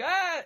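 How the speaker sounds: short, high mock scream; quick high "ah" of movie panic; imitated scream. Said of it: "and they're like".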